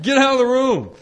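re-enacted angry yell, high and very loud; snapping shout. Caretaker who snapped, ordering the kids out, angry shout. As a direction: explode at the kids at full volume. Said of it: "I snapped, and I'm like".

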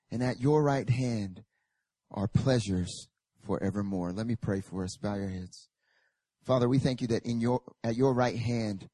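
ordinary delivery

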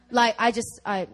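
normal talking voice

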